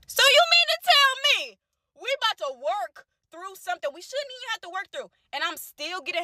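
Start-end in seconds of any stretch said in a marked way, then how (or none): 0.1-4.3 outraged disbelief. Real, not acted. Reacting to the other woman's text, outraged and high. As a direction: rise up in indignant shock.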